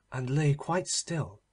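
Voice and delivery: ordinary delivery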